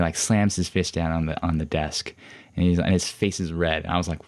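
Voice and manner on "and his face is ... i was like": ordinary delivery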